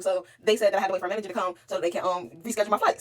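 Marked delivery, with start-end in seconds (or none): none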